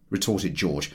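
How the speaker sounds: ordinary delivery, nothing marked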